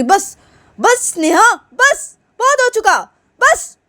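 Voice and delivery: high, loud cry of enough; high shrill shout. Acted. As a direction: yell it out to stop her. Neha stands up, shouts enough.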